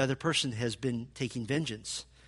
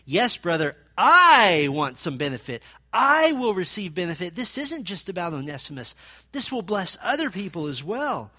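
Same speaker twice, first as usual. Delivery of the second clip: louder emphatic reading; emphatic paraphrase; 4 semitones higher, 7 dB louder than usual. Shown as a demonstration. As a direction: declare it with full force. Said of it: "about the best we can do is to say".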